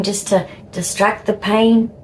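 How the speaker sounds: ordinary talk, nothing marked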